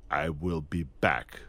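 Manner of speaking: low, slow, deliberate machine line; machine-like delivery. Retold or imitated. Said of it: "a machine".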